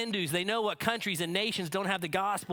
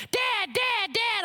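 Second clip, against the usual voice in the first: put-on high, loud child's call; a daughter bursting in, yelling for dad; kid yelling Dad; 5 dB louder, slower than usual. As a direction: call out like an excited little girl. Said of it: "she's just yelling".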